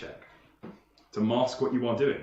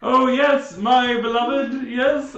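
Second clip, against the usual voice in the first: high, loud, slow lovesick nobleman; gushing noble suitor; 9 semitones higher, 6 dB louder, slower than usual. Acted. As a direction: swoon over her as a hammy lord. GM voices suitor through door.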